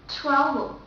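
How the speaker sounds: strong English TR at the start of "travel". Shown as a demonstration. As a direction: say "travel" with a firm TR. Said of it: "the T R in the word travel is much stronger".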